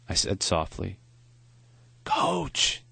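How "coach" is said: higher, faint frail man's voice; weak old man voice. Acted. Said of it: "he corrected".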